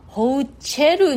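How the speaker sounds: ホーチェル with a "ch" sound and the stress on チェ; hochel sound. Shown as a demonstration. Teacher showing listeners how English hotel sounds. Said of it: "ホーチェルに近い".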